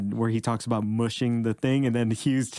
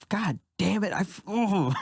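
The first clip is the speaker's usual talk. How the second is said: higher mock-frustrated curse; imitating Tony; 9 semitones higher than usual. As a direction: play the jealous comic, pitched up. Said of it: "And Tony's like".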